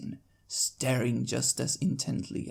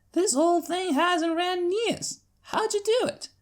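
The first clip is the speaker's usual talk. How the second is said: marveling Applejack; 6 dB louder than usual. Acted. Applejack asks about the watch.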